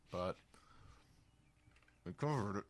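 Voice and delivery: words garbled by a pen held in the mouth. Real, not acted. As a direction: talk around a pen in your teeth. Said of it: "Sorry, I said that with a pen in my mouth".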